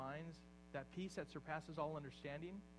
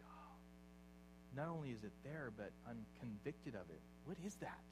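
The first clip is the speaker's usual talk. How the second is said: hushed realization; 4 semitones lower, 7 dB quieter than usual. Acted. Voicing a convicted believer.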